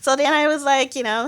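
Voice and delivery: normal talking voice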